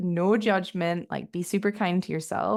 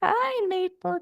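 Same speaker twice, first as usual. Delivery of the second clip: pet voice; 13 semitones higher, slower than usual. Real, not acted. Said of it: "talking in my Maple dog voice".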